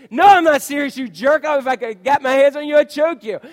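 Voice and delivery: loud, high mock shouting at a friend; hammed-up fury, playfully yelling jerk; a pretend rage yelled at the prankster friend; mock angry outburst.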